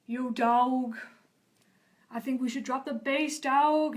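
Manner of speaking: lightly voiced cartoon DJ bear; a hint of hip cartoon bear; cool doll character. Voicing Techno Bear doll in pitch.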